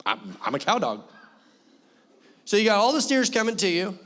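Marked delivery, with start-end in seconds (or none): 0.0-1.0 lower, proud little dog declaring itself; a dog bragging it's a real cow dog, voice pitched lower. Retold or imitated. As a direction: play the dog, chest puffed out and low. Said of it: "She's like".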